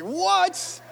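high, startled what; mock-shocked what; stunned what. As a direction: blurt it out in disbelief. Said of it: "I was like".

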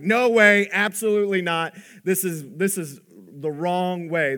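loud, high, outraged shout of refusal; protest yell. Retold or imitated. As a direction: yell it like a man refusing outright. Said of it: "he's yelling".

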